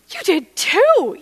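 high, drawn-out accusing retort; indignant comeback. Retold or imitated. Customer contradicting the salesman who denies it, high indignant retort. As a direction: throw it back indignantly, stretched out and high. Contradicts the seller's denial, arguing.

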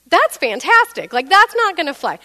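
loud, high mock delight turning to refusal; indignant sarcasm. Customer rejecting a half-refund offer, loud high sarcasm. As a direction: scoff loudly at a poor offer.

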